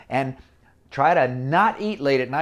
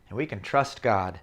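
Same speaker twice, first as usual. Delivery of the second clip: ordinary delivery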